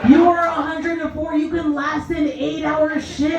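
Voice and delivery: high-pitched, drawn-out exasperated rant; exasperated complaint. Acted. Night-shift nurse to a dying elderly patient, exasperated.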